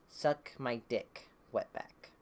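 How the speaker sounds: slow, low, breathy threat under the breath; menacing low whisper. Retold or imitated. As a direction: hiss the insult slowly and low, close to his face. Nazi threatens him with screwdriver.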